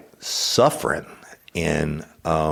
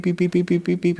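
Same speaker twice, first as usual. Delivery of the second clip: rapid beeps pitched far higher; alarm beeps; 14 semitones higher, faster than usual. Retold or imitated. Fever thermometer going off, rapid high beeping. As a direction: fire off frantic beeps like an alarm. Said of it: "When this one got done".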